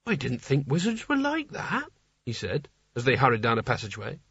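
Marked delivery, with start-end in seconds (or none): 0.0-1.9 high, far-from-narrator character voice; shocked naive Nigel. A narrator's reading. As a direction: voice a dismayed naive innocent, pitched up.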